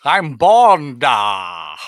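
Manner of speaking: loud parody secret agent; hammed-up Scottish star, dropping at the end; Sean Connery. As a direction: boom it out as a mock film hero. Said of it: "We're just doing Sean Connery movies".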